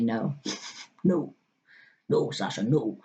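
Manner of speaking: low Scottish scolding; stern inner voice with a Scottish accent. Acted. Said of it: "hear that Scottish accent in my head".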